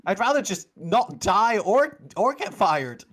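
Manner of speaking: high, loud protesting guard; exasperated high-voiced underling; indignant guard. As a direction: spell it out slowly in a worked-up high voice. Guard pleads in character.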